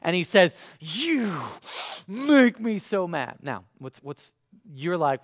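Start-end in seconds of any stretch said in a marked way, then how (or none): 0.8-3.4 milder mock child tantrum, drawn out; half-strength imitation of a tantrum; retold temper tantrum. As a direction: imitate a cross five-year-old, slowly, with a break after YOU. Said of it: "like, temper tantrum".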